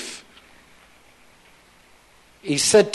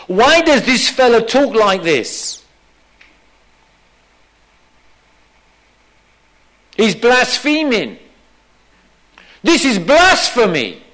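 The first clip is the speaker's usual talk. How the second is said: higher, mildly outraged accusing voice; indignant; 5 semitones higher, 4 dB louder than usual. Acted. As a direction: accuse him like a scandalised religious teacher. Acts out the teachers' accusing thoughts.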